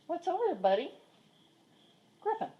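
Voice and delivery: high curious coo to the cat; high pet talk. Real, not acted. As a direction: ask the kitty up high. Cooing to the cat.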